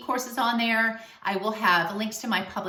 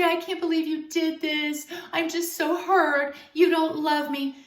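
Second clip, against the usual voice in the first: higher, slow, whiny mock sobbing voice; crying, whiny; 6 semitones higher than usual. Hurt girlfriend pleading with her man, mock crying whine. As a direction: ham up the hurt girlfriend, whining. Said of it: "in a very crying, whiny way".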